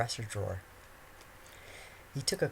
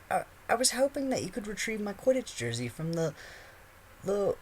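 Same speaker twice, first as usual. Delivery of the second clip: put-on high, stammering teen boy; 11 semitones higher than usual. A narrator's reading. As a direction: stumble nervously. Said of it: "Harry began".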